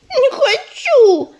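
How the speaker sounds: put-on high, slow childish whine; whining. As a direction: whimper it like a sulky kitten. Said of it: "захныкала малышка".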